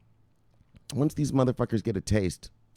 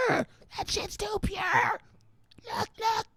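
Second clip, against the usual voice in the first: much higher, slow, strained squeal; mock wail; 6 dB quieter, slower than usual. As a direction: squeal it out overwhelmed. Repeated line, squealed wail.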